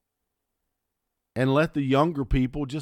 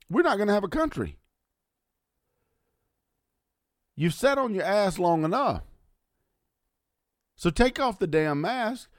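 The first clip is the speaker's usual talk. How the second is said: high, heated rallying rant; angry rant; 7 semitones higher than usual. Real, not acted. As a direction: rile the listeners up, furious. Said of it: "I'm angry".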